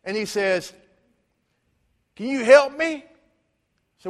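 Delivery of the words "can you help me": higher, louder pleading voice of the beggar. Retold or imitated. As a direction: ask for help like a needy stranger. Beggar asking preacher for help.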